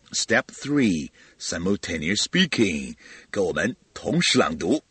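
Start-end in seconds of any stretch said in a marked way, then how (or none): none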